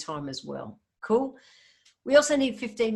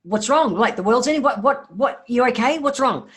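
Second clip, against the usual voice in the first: louder, higher, hammed-up panic asking what's wrong; mock alarmed partner; 4 semitones higher, 9 dB louder than usual. Partner startled by a sudden request to chat, mock panicked and loud. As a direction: play the startled partner who thinks the world is ending. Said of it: "They're gonna go".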